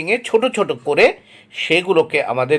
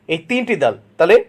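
ordinary delivery

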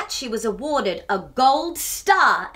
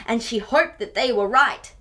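plain reading voice

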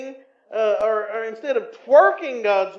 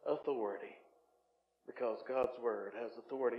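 hushed emphasis; 10 semitones lower, 14 dB quieter, faster than usual. Acted. Drop after preaching climax.

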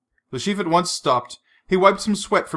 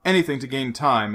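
usual speaking voice

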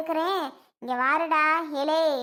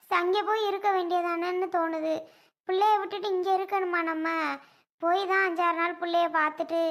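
ordinary talk

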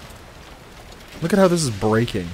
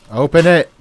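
panicking gamer; 7 semitones higher, 10 dB louder than usual. Real, not acted. Trapped, door won't open.

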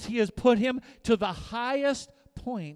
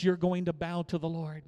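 ordinary delivery

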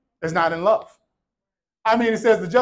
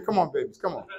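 soft, low coaxing; acted soothing call to children; gentle grandparent; 6 semitones lower, 7 dB quieter than usual. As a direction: coax the little ones over tenderly. Voicing gentle grandparents calling children.

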